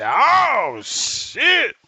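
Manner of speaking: loud, high, drawn-out shock; jolted by the news, let it fly up; shocked oh shit. Retold or imitated. Hearing she runs on octane, loud high shock.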